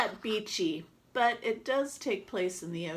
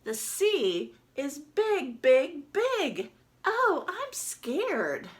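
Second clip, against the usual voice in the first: mock scared; 8 semitones higher, 5 dB louder, much slower than usual. Duck alone on big sea.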